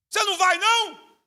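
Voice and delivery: high, loud incredulous question; can't believe he's staying; fleeing crowd asking Samar why he stays; alarmed disbelief. Retold or imitated. Fleeing people warn Samar.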